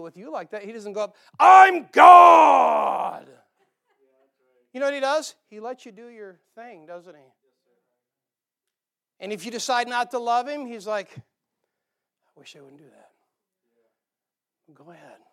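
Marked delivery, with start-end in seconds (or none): 1.4-3.3 a bellowed mock decree from on high; hammed-up boastful shout of I'm God; booming proclamation. 11.2-15.2 soft wistful God. Retold or imitated. God voiced gently, wistful.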